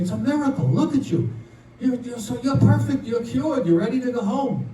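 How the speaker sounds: a touch of amazement, voice lifted; amazed doctors. Acted. Doctors to a patient waking from surgery, amazed. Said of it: "The doctors are in the room saying".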